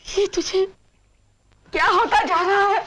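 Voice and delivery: high, tearful reproach; crying. Acted. Mother asking what is becoming of her son, crying. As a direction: plead with him through tears. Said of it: "रो मत मां".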